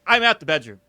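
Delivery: hammed-up nervous excuse; mock fright. Refusing to check the noise, loud mock panic. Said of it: "I'm like".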